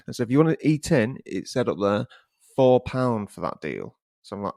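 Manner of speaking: usual speaking voice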